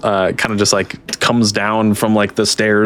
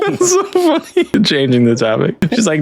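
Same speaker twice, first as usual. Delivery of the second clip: ordinary talk, nothing marked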